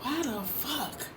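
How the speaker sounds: soft, slow, puzzled grumble; baffled mutter. Retold or imitated. Reader puzzled by cards she cannot read. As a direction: mutter it to yourself, confused. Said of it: "I'm like".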